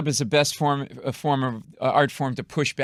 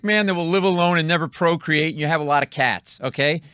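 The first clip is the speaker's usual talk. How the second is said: mock verbal assault; 4 semitones higher, 5 dB louder than usual. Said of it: "I go".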